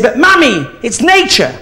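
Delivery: put-on high, loud little boy pleading; a cheeky boy insisting he's right; boy protesting to mummy in a high, loud voice; whiny child protest. Boy protesting to his mother.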